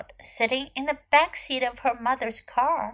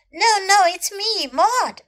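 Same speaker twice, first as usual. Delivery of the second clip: high, loud, excited correction; eager insistence; 8 semitones higher, 7 dB louder than usual. Acted. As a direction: insist excitedly on who you are. Maud insists on who she is.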